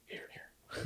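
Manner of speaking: whispered superhero imitation; barely voiced, secretive hero whisper; Batman. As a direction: whisper it as the lurking vigilante. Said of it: "I do like his Batman voice".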